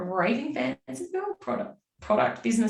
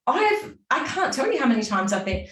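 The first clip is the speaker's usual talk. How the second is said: ordinary talk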